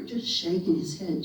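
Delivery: ordinary delivery, nothing marked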